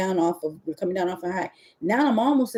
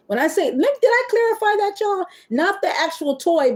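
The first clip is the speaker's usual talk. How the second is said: sudden high, louder correction; excited clarification; 13 semitones higher, 4 dB louder than usual. Real, not acted. Correcting herself to viewers on live, excited and high. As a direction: jump up in pitch to set the record straight. Clarifying rose not toy.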